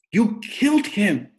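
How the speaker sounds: high, loud, slow accusing cry; anguished accusation. Acted. Rob to his father over the dead tiger, loud and anguished. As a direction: hurl it at him, broken.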